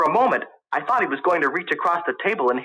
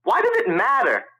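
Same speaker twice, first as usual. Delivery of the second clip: heated; 10 semitones higher than usual. A narrator's reading. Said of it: "beginning to lose his temper".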